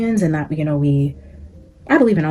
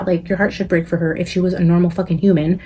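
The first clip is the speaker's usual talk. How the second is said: ordinary talk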